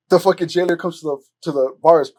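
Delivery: usual speaking voice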